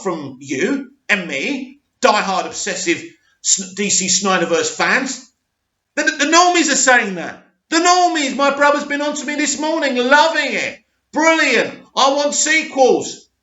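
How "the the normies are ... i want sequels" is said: high-pitched, excited insistence; thrilled, pitched well up. Real, not acted. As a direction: relay the rave praise with rising excitement. Excitedly relaying brother's and normies' praise.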